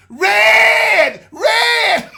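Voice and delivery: very loud, very high panicked yell; shouting. Retold or imitated. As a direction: scream it like a panicked passenger. Wife mimicking shouting at stoplight.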